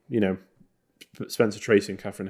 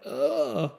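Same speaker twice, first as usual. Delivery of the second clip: high-pitched cringing "ugh"; pained, squeamish groan; disgusted groan; 10 semitones higher than usual. Retold or imitated. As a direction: groan as if wincing at something awkward. Reacting to racist film elements.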